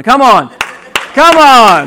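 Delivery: loud, high yell, building on the repeat; mock-outraged protest. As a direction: playfully shout in disbelief at your wife. Wife takes his planned example.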